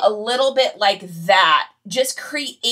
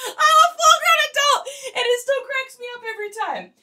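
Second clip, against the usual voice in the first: high, laughing through the words; can't stop giggling; laughing hard; 4 dB louder than usual. Real, not acted. Cracking up at her impression.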